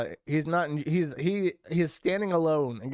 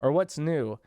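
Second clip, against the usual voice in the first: normal talking voice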